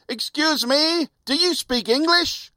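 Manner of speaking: high, slow lady's voice asking politely; a lady in a Cadillac asks a stranger, high and prim; posh lady driver. Retold or imitated. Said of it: "lowered the window and asked".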